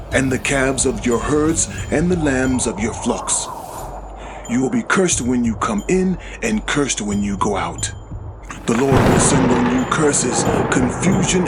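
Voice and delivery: usual speaking voice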